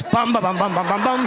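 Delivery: low bum bum bum like a tense soundtrack; deep ominous bum-bums; mock dramatic music sting. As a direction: hum a menacing theme.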